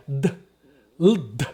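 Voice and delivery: "d" as a bare consonant without vowel; mute D. Shown as a demonstration. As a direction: isolate "l" then a silent-ended "d". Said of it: "o D mudo é".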